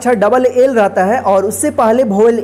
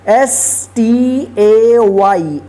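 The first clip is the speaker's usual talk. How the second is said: letters spelled out slowly one by one; slow spelling; much slower than usual. Shown as a demonstration. Teacher spells word letter by letter.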